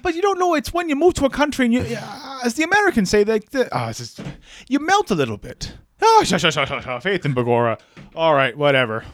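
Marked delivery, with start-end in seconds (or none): 0.0-7.8 high, fast mock Irish accent. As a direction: ham up a stage Irish accent. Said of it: "I'm getting a little Irish now".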